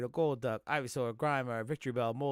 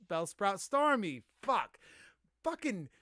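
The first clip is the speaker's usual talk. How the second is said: frustrated; 5 semitones higher, 3 dB louder than usual. Real, not acted. Fumbles the rap lyric.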